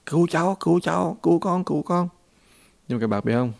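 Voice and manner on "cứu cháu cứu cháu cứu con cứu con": put-on higher-pitched child's plea, at normal volume; child crying for help. Trapped child calling to passing monks, high pleading cries. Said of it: "là cứ kêu".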